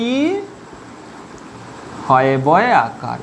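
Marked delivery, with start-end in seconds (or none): none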